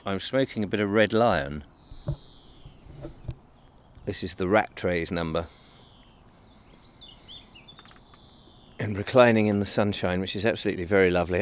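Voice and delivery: talking around a pipe stem; puffing a pipe between words; pipe in mouth. Real, not acted. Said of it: "Excuse me whilst I light my pipe again".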